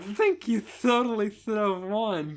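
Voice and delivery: put-on high lisping girl. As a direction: lisp through your teeth. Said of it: "She's not gargling".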